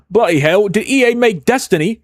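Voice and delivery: high, hammed-up British accent; a Canadian's poor go at sounding British; bad British accent. Streamer reading out a chat question, in a mock British accent. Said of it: "poor Canadian's interpretation of a British accent".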